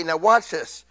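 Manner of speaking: normal talking voice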